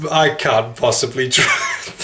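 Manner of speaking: ordinary delivery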